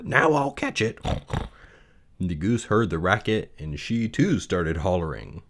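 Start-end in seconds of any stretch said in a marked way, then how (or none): 0.0-1.0 Wilbur worried. Acted. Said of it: "thought Wilbur".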